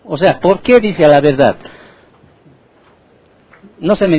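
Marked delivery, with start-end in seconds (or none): none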